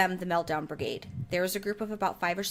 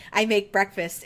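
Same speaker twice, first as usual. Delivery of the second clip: ordinary talk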